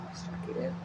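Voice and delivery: soft whispered echo. Shown as a demonstration. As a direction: whisper the name back to yourself. Echoes name as a whisper.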